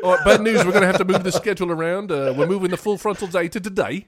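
put-on Australian accent. A crew member breaks schedule news in an Australian accent. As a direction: play an Australian film producer. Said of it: "is Australian?".

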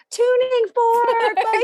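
very high, slow squealed call; mock fan scream. Imagined yelling at [name] across the street, squealed high. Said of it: "I had just been like".

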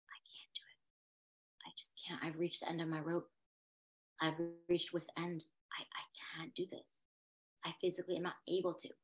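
very soft, half-whispered surrender; drained, hushed confession; retold despairing plea. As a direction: let the voice drop away, as if giving up. Retells despairing plea to family.

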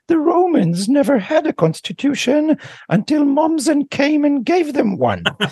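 high, slow English professor; Professor Jones English accent. Retold or imitated. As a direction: drawl it like an old English don. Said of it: "ולג'ונס היה מבטא אנגלי".

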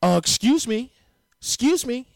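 high, loud put-on rich man. Shown as a demonstration. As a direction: caricature a wealthy man demanding attention.

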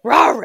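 high loud dog bark; loud imitated bark. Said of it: "he'll go to bark".

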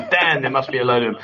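ordinary talk

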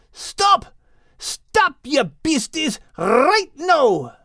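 high commanding yells; shouting. A narrator's reading. Max shouting at the charging horses to stop. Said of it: "Max started shouting at the horses".

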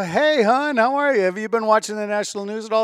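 high, loud, sing-song innocence; feigned casual greeting. Retold or imitated. Husband checking what wife knows, mock-innocent high chirp. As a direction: play it too breezy, pitched right up.

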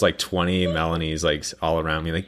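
normal talking voice

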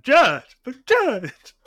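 mock the lawyer's whine to the judge; whiny lawyer. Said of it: "in my head had".

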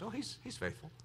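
very soft, near-whispered aside; small, quiet voice of faith; hushed realization. Acted. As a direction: say it under the breath, as a self-reminder. Acting out a turn to faith.

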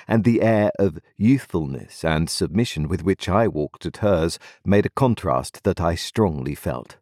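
ordinary delivery, nothing marked

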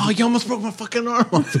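high, indignant yelp as the bruised captive; mock outrage. Retelling a movie scene, friend mimics a furious, indignant bounty hunter. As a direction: squeal it like a man nearly losing an arm. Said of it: "he's like".

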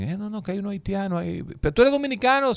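high, quick mocking questioner voice; quoted questioner. Retelling the guard questioning him, quick high voice. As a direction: mimic a suspicious official, quick and high. Retold border exchange, questioning.

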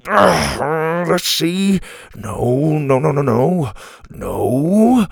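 mild flustered muttering through options; panicked searching. A narrator's reading. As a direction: fumble through it with a touch of panic. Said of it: "in a panic".

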